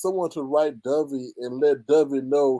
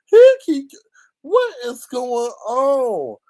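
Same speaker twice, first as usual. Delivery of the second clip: disbelief; 9 semitones higher, 7 dB louder, much slower than usual. Real, not acted. Laughing disbelief at the story.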